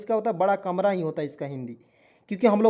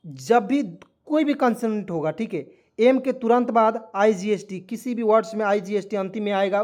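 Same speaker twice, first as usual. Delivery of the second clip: usual speaking voice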